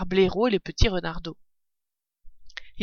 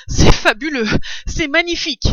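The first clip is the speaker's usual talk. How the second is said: high, loud breath-catching rapture; 8 semitones higher, 8 dB louder than usual. A narrator's reading. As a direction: rave while recovering from the gulp. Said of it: "en essayant de retrouver sa respiration". (extase, souffle court, voix haute)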